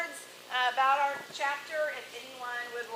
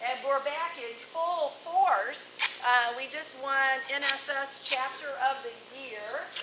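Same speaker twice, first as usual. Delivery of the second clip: ordinary talk, nothing marked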